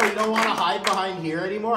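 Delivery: normal talking voice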